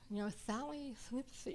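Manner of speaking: "Sally Slips" lisped, every s through the gap in the teeth; "Sally Slips" with a lisp on each s; toothless lisp. Shown as a demonstration. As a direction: say "Sally Slips" like a kid missing front teeth. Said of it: "with no two front teeth".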